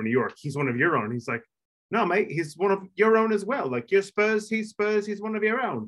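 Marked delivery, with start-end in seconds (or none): 1.9-5.1 high-pitched, hammed-up British accent; bad British accent. Spurs fan welcoming an American at a wedding, bad British accent. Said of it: "that was a really bad British accent". 5.1-5.8 much higher, hammed-up British accent; bad British accent. English guest reassuring an American Spurs fan, bad British accent. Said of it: "that was a really bad British accent".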